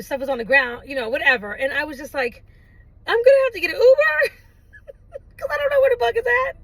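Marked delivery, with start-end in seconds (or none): 3.1-6.6 high, loud, quick wail breaking into laughter; hammed-up panicky complaint, laughing midway; mock meltdown. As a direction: wail it in comic despair. Re-enacting her meltdown over the bug.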